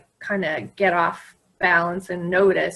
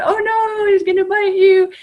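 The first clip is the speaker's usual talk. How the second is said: hammed-up alarm, much higher and louder; mock scared warning; 11 semitones higher, 7 dB louder than usual. Teasing the girl at graduation, mock alarm. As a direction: playfully pretend terror. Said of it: "she starts laughing".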